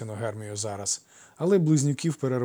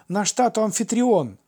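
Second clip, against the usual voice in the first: put-on high, loud boys' declaration; emphatic insistence; 11 semitones higher, 7 dB louder than usual. A narrator's reading. Twins insist on their father. (наполегливий дитячий вигук)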